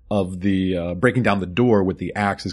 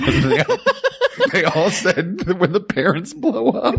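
high laughing voice; laughing through the words; 10 semitones higher than usual. Real, not acted. Laughing while telling.

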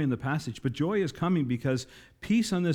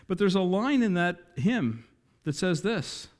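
normal talking voice

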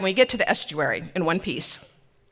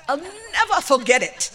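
high, slow, drawn-out declaration; proud delight; 8 semitones higher, 4 dB louder than usual. Retold or imitated. Husband recalls class to wife, high proud drawl. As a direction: stretch it out high with relish.

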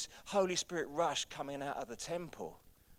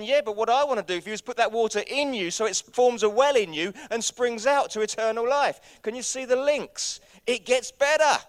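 raised, driving sermon voice; full-force preaching; 8 semitones higher, 11 dB louder than usual. Real, not acted. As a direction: preach it high and loud to the church.

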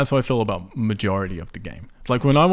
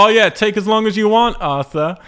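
mocking NPC voice, higher and louder; sarcastic taunt, pitched up and loud; sarcastic berating; 10 semitones higher, 9 dB louder than usual. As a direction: sneer it like an impatient gang member. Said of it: "just berating me".

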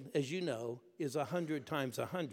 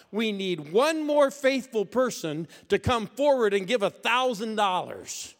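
loud, higher, slow preacher's call; bishop's shout; 7 semitones higher, 9 dB louder, slower than usual. Retold or imitated. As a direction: boom it out like a preacher. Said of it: "I hear the bishop shout".

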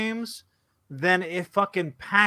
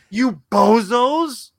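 slow, louder jeer; scornful insult; 3 semitones higher, 6 dB louder, slower than usual. Acted. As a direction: sneer it at them slowly. Insulting complaining fans.

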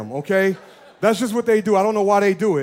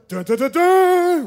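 console startup jingle; 6 semitones higher, 4 dB louder, slower than usual. Retold or imitated. Pastor imitates the startup sound he expected, high and slow. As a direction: sing a high, slow Dun dun dun dun. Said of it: "it was gonna be like".

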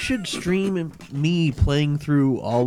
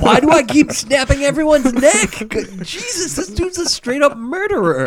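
much higher mock-horrified yelp; shocked disbelief; 13 semitones higher, 4 dB louder than usual. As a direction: ham up the horror of snapping necks by accident. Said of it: "you're like".

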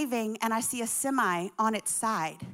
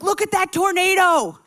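loud, raised mock yell; excited shout; 7 semitones higher, 8 dB louder than usual. Husband to wife pointing out a tornado, excited shout. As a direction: call it out in alarmed excitement.

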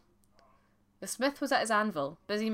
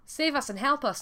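higher-pitched exclamation of surprise; startled exclamation; 7 semitones higher, 3 dB louder than usual. A narrator's reading. Shepherd's wife sees bannock over her porridge, startled exclamation. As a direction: exclaim it, taken aback. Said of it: "the wife exclaimed".

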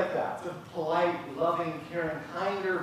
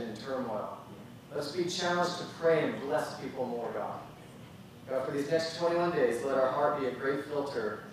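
prayer; 4 semitones lower than usual. Acted. Leads a closing prayer.

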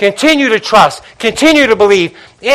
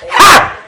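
joyful shout; 10 semitones higher than usual. Real, not acted. Shout of joy.

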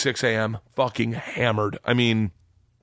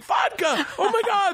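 thrilled shout. Retold or imitated. Student shouting with joy at the vodka. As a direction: yell it with glee.

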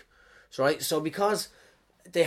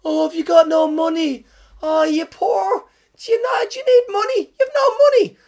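mock pitying bank manager; 10 dB louder than usual. Bank manager pitying a loan seeker, high mock sympathy. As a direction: coo at a broke customer like a sick child. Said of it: "bank manager comes around and goes".

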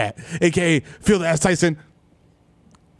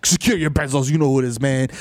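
ordinary delivery

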